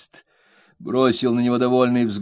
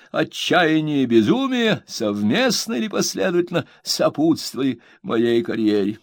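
relishing pompous reading; 8 semitones higher than usual. A narrator's reading. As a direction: savor every grand word. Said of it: "делал это с таким смаком".

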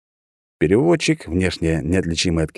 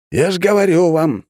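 nagging official; 11 semitones higher, 5 dB louder than usual. A narrator's reading. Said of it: "толдычил мой соотечественник".

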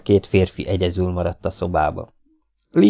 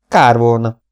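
higher, much louder sneering call; mocking voice; 4 semitones higher, 9 dB louder than usual. A narrator's reading. Masked stranger mocking from the window: loud sneer. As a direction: toss it in with mockery. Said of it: "egy gúnyos hang".

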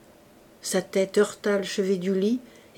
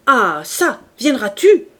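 loud, sharp demand rising at the end; angry summons; 4 semitones higher, 9 dB louder than usual. A narrator's reading. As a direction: order her over, out of patience. Javert barks order at Fantine.

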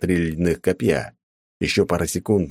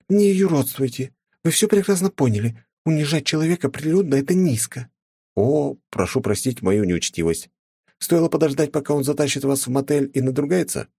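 возмущённая графиня; 9 semitones higher than usual. A narrator's reading. Argument with countess.

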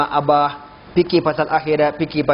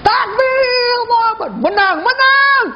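exaggerated victory chant; mock rally cries. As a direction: shout like a winning election crowd. Acts election victory rally cries.

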